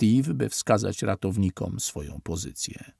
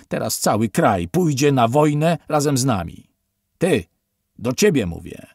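loud, higher, domineering; aggressive officer; 4 semitones higher, 6 dB louder than usual. A narrator's reading. Officer lecturing survivors on the fake attack, loud and domineering. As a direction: hammer the lie into them. Officer boasting, then singling out Steven.

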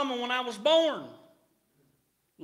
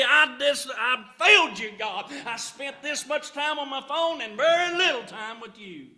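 loud, rising preacher's cadence; full-force preaching; 3 semitones higher, 5 dB louder than usual. Acted. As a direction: preach it out to the congregation.

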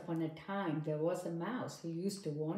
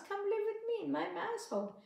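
much higher, fast mouse voice; squeaky mouse plea; 14 semitones higher, faster than usual. Acted. Mouse inviting the bride to his hole, high and fast. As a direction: rush the invitation like an eager mouse. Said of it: "said the mouse".

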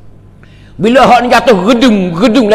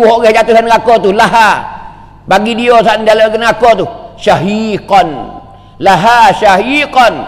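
normal talking voice

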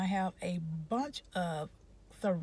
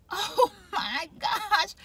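dismayed oh my gosh; 10 semitones higher, 4 dB louder, much slower than usual. Retold or imitated. Her own upset reaction retold.

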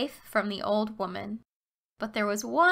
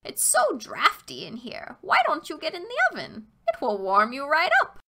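put-on sly old witch; 5 semitones higher than usual. A narrator's reading. Witch luring Gretel to oven.

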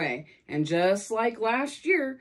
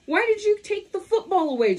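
high, louder indignant demand; angry question; 11 semitones higher, 4 dB louder than usual. Acted. Charlie Brown confronts Lucy angrily, high and loud. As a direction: demand an answer, upset. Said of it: "Charlie Brown asks Lucy angrily".

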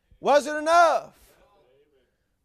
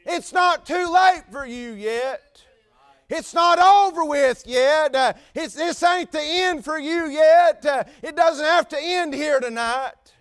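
louder, drawn-out pleading; urgent pleading; 8 semitones higher, 7 dB louder, slower than usual. Acted. Paul pleads with the jailer, loud slow plea. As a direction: plead with him to live.

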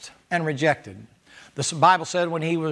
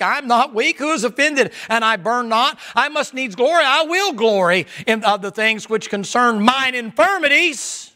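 higher, louder fervent reading; fervent scripture preaching; 4 semitones higher, 3 dB louder than usual. Acted. Preacher reading Paul's sufferings to the church, fervent and loud. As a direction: preach the verses with rising fire.

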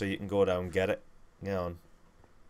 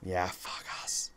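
whisper; 10 dB quieter, much slower than usual. Real, not acted. Whispered after a loss.

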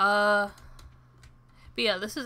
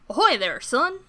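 a hint of a salty old sailor hailing; gruff fisherman voice; 3 semitones higher, 9 dB louder than usual. Player voicing the fisherman, a loud hearty sailor hail. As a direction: boom out a hearty sea-dog greeting. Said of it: "that was awful".

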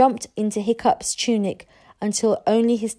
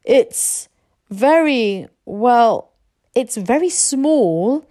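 put-on halting, higher boyish voice; hesitant admission; 4 semitones higher, 5 dB louder, much slower than usual. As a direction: stall between words as you confess. Boy haltingly admits to father.

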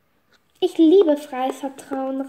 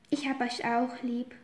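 tender; 2 semitones lower, 8 dB quieter than usual. Acted. Tender reconciliation.

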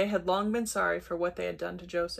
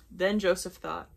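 usual speaking voice

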